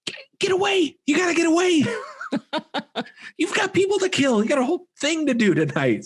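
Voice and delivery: very high, squealed comic plea; mock frantic urging. Viewer urging the movie killer to escape bikers, mock frantic. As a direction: egg him on in a strained, high mock panic.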